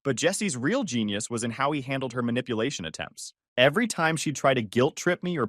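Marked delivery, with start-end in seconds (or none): none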